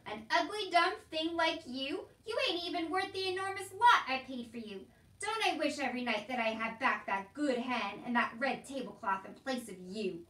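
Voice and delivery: loud, scornful tirade; berating uncle. A narrator's reading. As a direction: lay into the child with contempt. Uncle berates and mocks Meg.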